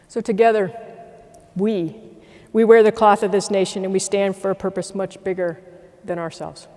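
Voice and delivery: formal closing address, stronger on key phrases; rally the airmen with pride; rallying speech. Acted. Leader closing his speech to airmen, rallying.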